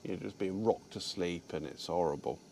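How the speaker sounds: usual speaking voice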